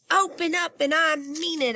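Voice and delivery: put-on high, pushy little girl shout; yelling kid. Girl yelling at the locked door, high and bossy. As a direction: bang on the door and yell it. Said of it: "I yelled".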